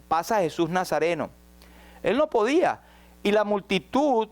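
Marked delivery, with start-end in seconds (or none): none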